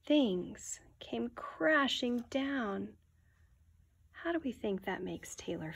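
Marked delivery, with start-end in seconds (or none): 0.0-2.9 put-on reading to children. A narrator's reading. Teacher reading a picture book aloud to the class, hushed. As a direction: draw the children into the story.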